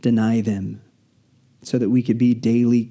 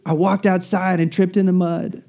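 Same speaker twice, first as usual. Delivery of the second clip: pitched way up, calling out excited; a kid bursting in yelling for mom; excited screaming child; 8 semitones higher than usual. Retold or imitated. Boy bursts in, calling to his mom, high and excited. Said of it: "opens the door and screams".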